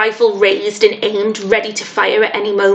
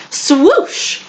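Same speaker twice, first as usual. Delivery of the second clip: swooshing sound; 6 semitones higher than usual. A narrator's reading. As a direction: make the swoop heard. Net swoops him up.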